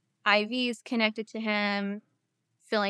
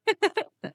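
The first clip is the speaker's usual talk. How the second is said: quick high burst of laughter; a real short laugh at the memory; brief real laugh; 11 semitones higher, 4 dB louder than usual. Real, not acted. Laughing at nurse story.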